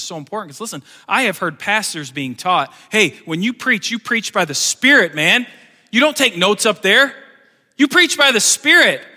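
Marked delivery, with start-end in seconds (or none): 2.9-9.1 mimicked teacher urging preachers, rising louder and higher; mock preaching mentor. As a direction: build to an insistent, high exhortation.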